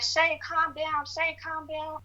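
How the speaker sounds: imitating the roommate telling her to calm down; mock calm roommate. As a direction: mimic her hushed, drawn-out "calm down". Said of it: "She's like".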